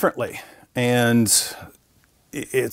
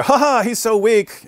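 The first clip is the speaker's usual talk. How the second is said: high, slow jeering laugh-line; mocking; 9 semitones higher, slower than usual. Muslims walking out of a debate unimpressed, mocking. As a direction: gloat at a feeble opponent.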